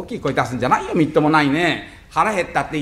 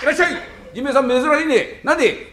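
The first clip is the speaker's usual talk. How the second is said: high, lively shop greeting; high, lively fishmonger; 9 semitones higher than usual. Acted.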